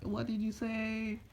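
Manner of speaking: high, slow, stretched-out challenge; mock outrage. Naruto insulted by Kurama, mock outrage. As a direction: draw it out high, as if offended.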